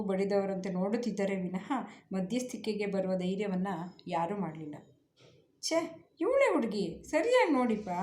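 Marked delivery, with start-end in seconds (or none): 5.6-7.9 high, worried pleading; a touch of panic, pitched up; anxious plea. Acted. Uncle pleading with the groom's father, anxious and high. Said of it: "ಆತಂಕದಿಂದ ನೋಡಿದ್ರು".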